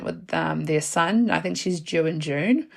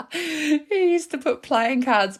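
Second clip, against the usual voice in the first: laughing; 9 semitones higher, slower than usual. Real, not acted. Laughing while confessing drug mule story.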